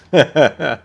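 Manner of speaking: loud laugh. Real, not acted. Laughing at own joke.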